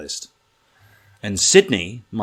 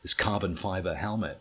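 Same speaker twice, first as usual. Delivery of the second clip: usual speaking voice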